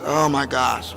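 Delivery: low, deflated groan of dismay; sad, weary dread. Retold or imitated. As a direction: imitate someone dreading work, pitch dropped. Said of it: "they get sad".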